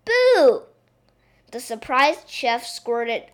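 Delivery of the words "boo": high, loud playful boo; bellowing. Acted. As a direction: jump out and shout it. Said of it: "then bellowed".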